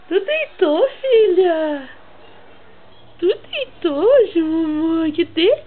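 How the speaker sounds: usual speaking voice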